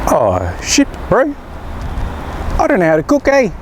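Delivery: a hint of a New Zealand bloke; lightly voiced Kiwi guy, New Zealand twang; clueless young man in a light Kiwi accent. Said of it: "the guy in my head is Kiwi".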